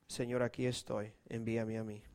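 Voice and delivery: a whispered here I am; hushed surrender. Shown as a demonstration. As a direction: lay it down gently before God. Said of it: "le han dicho al Señor".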